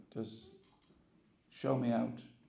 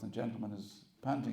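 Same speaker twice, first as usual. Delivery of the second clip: ordinary talk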